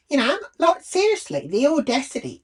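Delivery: high, loud outraged disbelief; venting at the audacity of it. Real, not acted. Telling viewers about the ranger, high and loud with outrage.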